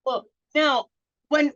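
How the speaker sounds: normal talking voice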